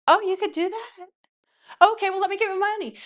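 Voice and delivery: put-on very high, hurried girly voice; pretend girlfriend. TikTok girlfriend offering to get her money, high-pitched eager imitation. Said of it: "it's him pretending to be a girl".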